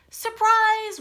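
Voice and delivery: high, loud Surprise!; big storybook surprise; excited reveal. Acted. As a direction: burst out the reveal to the kids. Repeated reveal in read-aloud.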